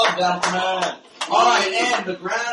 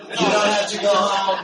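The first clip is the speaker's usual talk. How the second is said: ordinary delivery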